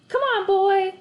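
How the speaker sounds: high, loud, delighted call to the dog; beaming. A narrator's reading. Boy grabbing the leash, calling his new dog, high, loud and beaming. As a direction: call the puppy with bursting joy. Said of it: "I beamed".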